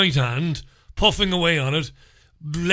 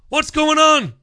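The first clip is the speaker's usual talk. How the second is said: high, loud exasperated cry; 11 semitones higher, 6 dB louder than usual. Real, not acted. Exasperated outburst locked outside.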